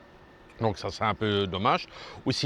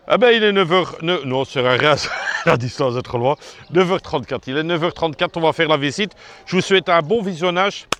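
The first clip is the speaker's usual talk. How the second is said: excited; 5 semitones higher, 7 dB louder than usual. Real, not acted. Starting the visit, energised.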